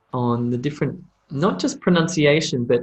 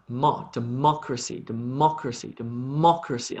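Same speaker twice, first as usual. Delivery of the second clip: "democracy" with the weight on "mo", the second syllable; stress on MO; 4 dB quieter than usual. Shown as a demonstration. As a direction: lean on "mo" in "democracy", de-MO-cra-cy. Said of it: "I'm putting emphasis there".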